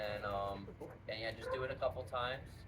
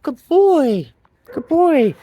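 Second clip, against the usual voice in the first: dog praise voice; 25 dB louder, much slower than usual. Real, not acted. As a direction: praise the pup.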